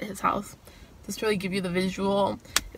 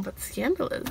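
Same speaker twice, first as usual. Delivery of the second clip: scandalous tone; 7 dB quieter than usual. Shown as a demonstration. Telling viewers about a first cuddle, teasing scandal tone. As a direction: purr the word like it's juicy gossip. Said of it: "I don't know why I say it in that tone".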